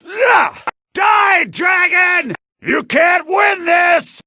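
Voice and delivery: high loud war shout; battle cry. Acted. Warrior charges a dragon with a battle cry. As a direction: roar defiance at the dragon.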